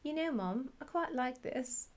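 put-on a little boy's Scottish lilt; Scottish accent. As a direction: imitate a small child's Scottish accent. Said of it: "in his tiny Scottish accent".

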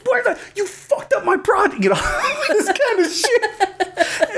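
high, squealing mock rage; yelling. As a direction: scold the plant in comic outrage. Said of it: "I'm, like, yelling".

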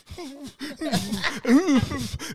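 high fake sobs held in; stifled mock crying. Comic trying to hold it in, stifled mock sobs. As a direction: try to swallow a pretend cry. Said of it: "making it worse 'cause I'm like".

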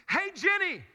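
loud call across the house; hollering for his wife. Retold or imitated. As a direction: shout her name from another room. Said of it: "You go".

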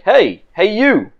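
sharp call of alarm from a guard; guards shouting. Acted.

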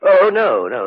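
jumpy startled no. Acted. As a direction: caught off guard, blurt it out. Startled by question about flask.

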